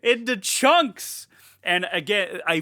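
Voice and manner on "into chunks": loud, high and drawn-out; gleeful excitement. Real, not acted. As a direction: hammer each word with gory delight. Relishing the film's shock moment.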